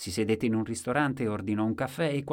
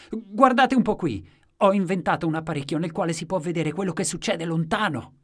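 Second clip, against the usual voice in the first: loud, high-pitched proclamation; excited exclamation; 9 semitones higher, 8 dB louder than usual. A narrator's reading. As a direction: show off to a whole crowd at full voice. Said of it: "ed esclamava".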